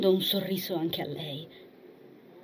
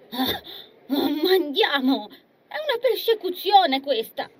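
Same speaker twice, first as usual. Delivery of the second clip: exasperated high whine; grumbling complaint; 8 semitones higher, 3 dB louder than usual. Acted. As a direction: groan in mock despair. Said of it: "e grugnì". (lamentela esasperata)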